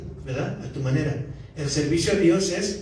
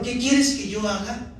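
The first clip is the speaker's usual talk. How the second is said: earnest plea; 5 semitones higher than usual. Retold or imitated. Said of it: "Señor".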